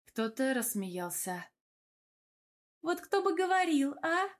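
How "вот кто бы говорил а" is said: high-pitched indignant retort; indignant woman's comeback, voice raised in pitch. A narrator's reading. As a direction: pitch it up in mock outrage. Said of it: "тихо возмутилась Эльвира".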